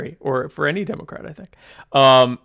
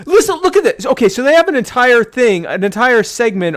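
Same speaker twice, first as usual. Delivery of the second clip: excited; 9 semitones higher, 7 dB louder than usual. Real, not acted. Excitedly showing something.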